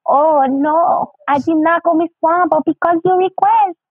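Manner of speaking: high mock foreign accent; Miss Swan. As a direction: put on a high, sing-song foreign accent. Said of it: "That's a pretty good Miss Swan".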